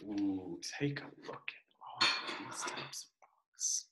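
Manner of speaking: hushed, breathy whisper. Real, not acted. Teacher showing the class the rock pictures, soft whisper. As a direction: whisper it to draw them in.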